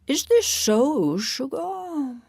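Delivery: high, lilting question; wondering, high-pitched address; wondering Marcy. Acted. Marcy asks Sugar.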